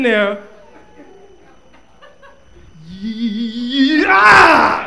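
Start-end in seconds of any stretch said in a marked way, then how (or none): 2.9-4.9 loud strained cry; hammed-up craving yell; mock addict cry. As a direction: wail like a junkie needing a fix.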